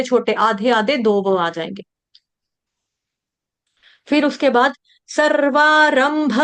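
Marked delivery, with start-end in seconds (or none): none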